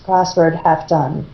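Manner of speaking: ordinary delivery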